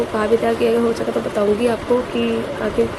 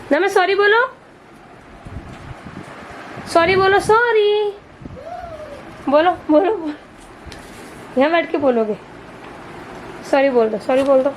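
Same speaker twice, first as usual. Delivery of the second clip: coaxing a pet; 6 semitones higher than usual. Real, not acted. To her parrot, a high, pleading coax to say sorry. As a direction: keep urging the bird to talk.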